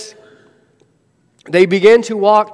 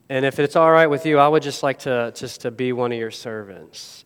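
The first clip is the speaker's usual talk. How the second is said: hint of humble son; 5 semitones lower, 4 dB quieter than usual. Son begs to be a servant.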